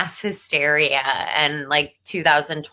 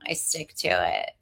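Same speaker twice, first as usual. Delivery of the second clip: low stubborn mutter; 13 semitones lower, 8 dB quieter than usual. Real, not acted. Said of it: "stubborn shrug".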